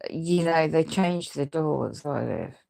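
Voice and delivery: ordinary delivery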